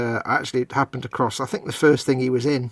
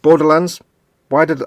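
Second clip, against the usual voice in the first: sudden recall blurt; 4 semitones higher, 9 dB louder than usual. Retold or imitated. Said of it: "I went".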